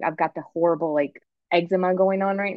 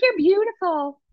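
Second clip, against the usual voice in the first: high-pitched, gushing mother; imitating mom; 10 semitones higher than usual. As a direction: coo praise way up high, as a mock doting mom. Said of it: "my mom's like".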